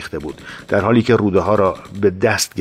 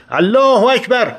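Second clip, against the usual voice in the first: high shouted Allahu Akbar, الله hit hardest; charging battle cry behind Reza; 13 semitones higher, 3 dB louder than usual. Retold or imitated. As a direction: roar الله اکبر to rally the men. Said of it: "فریاد زدم".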